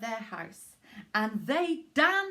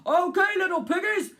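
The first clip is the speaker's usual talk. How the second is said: louder, higher call down a chimney; shouting down the chimney; 6 semitones higher, 7 dB louder than usual. Acted. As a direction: call out to the piggies below. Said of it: "he shouted down the chimney".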